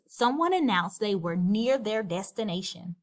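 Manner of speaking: ordinary delivery, nothing marked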